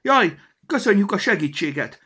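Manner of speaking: high, loud alarmed cry; cried out. A narrator's reading. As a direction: cry out to stop them. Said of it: "kiáltotta".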